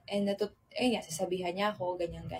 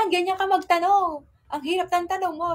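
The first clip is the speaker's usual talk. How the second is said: loud, higher mock complaint; exasperated partner; 8 semitones higher, 5 dB louder than usual. Partner teasing her for asking tricky questions, loud exasperated. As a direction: throw up your hands at a silly question.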